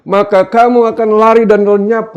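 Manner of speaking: high, loud declamation; ringing scripture proclamation; God's pronouncement. Acted. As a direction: thunder it out as God's verdict. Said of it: "kata Tuhan".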